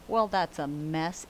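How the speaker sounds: usual speaking voice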